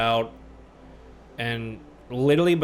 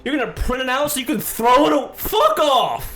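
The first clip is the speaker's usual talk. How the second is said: high and loud, breaking into a laugh; furious rant; 10 semitones higher, 5 dB louder than usual. Real, not acted. Venting to a friend about the clerk, angry then laughing. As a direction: fume until it cracks into laughter.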